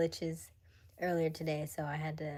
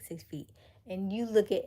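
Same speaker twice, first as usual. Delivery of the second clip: normal talking voice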